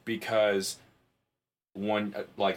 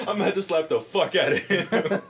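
loud, higher mock threat with laughter; retold fury; 6 semitones higher, 5 dB louder than usual. Host retelling his reaction to the priest, in laughing outrage. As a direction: threaten loudly, half laughing. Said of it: "I got so fucking mad".

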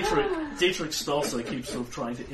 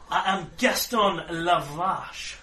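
offended pompous Frenchman; 4 semitones higher, 6 dB louder than usual. Acted. Offended Frenchman Gaston Lavache.

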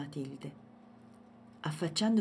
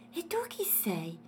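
higher, mildly amazed character voice; astonished question; 4 semitones higher than usual. A narrator's reading. Elephant asks the girl who she is, astonished. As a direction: ask in puzzled wonder. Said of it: "chiese lui altrettanto stupito".

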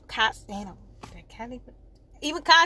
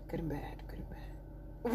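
trailing whispered repetition; whispered aside; 7 semitones lower, 14 dB quieter than usual. Real, not acted. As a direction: repeat it under the breath, barely there. Repeats it fading into a whisper.

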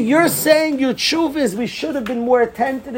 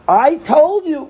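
raised, loud told-you; lightly scolding Reuven; 7 semitones higher, 5 dB louder, slower than usual. Retold or imitated. Reuven answers the guilty brothers, lightly scolding. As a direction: rub it in.

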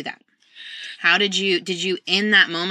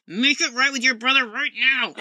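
stern parent laughing; 6 semitones higher than usual. Retold or imitated. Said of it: "I know they're supposed to reconcile".

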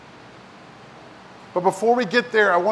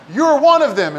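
raised accusing voice, higher and louder; a bystander accusing Peter, louder and higher; 6 semitones higher, 6 dB louder than usual. Retold or imitated. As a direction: call him out as one of them. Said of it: "someone else saw him and said".

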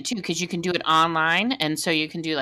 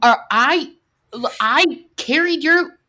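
raised, higher mock outrage at her daughter; inner outrage; 6 semitones higher, 4 dB louder, slower than usual. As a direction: blurt out the hurt mother's indignation, half joking. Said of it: "And I was just like".

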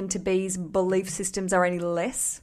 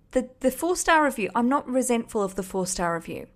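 ordinary talk, nothing marked

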